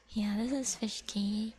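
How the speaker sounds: ordinary talk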